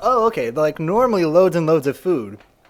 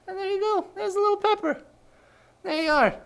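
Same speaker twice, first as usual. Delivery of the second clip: much higher cutesy voice. Real, not acted. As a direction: coo at the tiny pepper. Presenting the little pepper.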